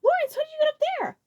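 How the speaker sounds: high, loud amazed call; startled high exclamation; astonished. A narrator's reading. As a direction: shout up in disbelief. Iggy amazed.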